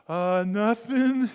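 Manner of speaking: put-on slow, guilty little kid voice; child caught out of bed answers a parent, slow and sheepish.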